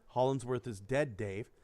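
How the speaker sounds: low, soft deadpan computer; Holly. Retold or imitated. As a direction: deliver bad news with no reaction, voice dropping. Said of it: "who's the ship's computer".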